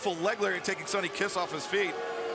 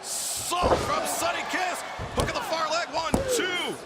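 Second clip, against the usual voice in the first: excited commentator; 9 semitones higher than usual. Real, not acted. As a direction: call the big move at full excitement. Excited near-fall call.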